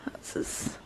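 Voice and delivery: soft unvoiced whisper. Real, not acted. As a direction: whisper it to yourself. Whispered aside about bed.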